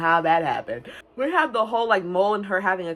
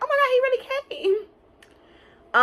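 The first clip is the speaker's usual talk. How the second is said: excited gush. Real, not acted. Gushing over the scene.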